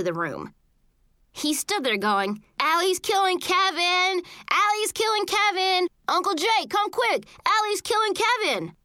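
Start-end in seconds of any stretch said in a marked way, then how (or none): 2.6-8.8 put-on high, urgent kid's holler; alarmed calling out. A narrator's reading. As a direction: call an adult to come, repeating it like an alarm. Brother raises alarm to uncle.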